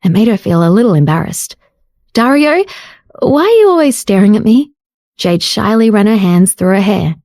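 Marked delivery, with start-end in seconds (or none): 2.1-4.7 put-on higher, bashful young woman; shy female voice; shy question. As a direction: ask him coyly.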